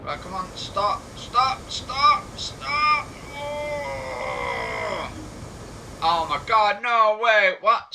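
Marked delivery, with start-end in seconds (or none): 0.5-3.1 rising, ever louder stops; panicked shouted stop; urgent stop. Real, not acted. Braking the train too late. 3.4-7.9 shocked disbelief. Real, not acted. Train overshoots the signal.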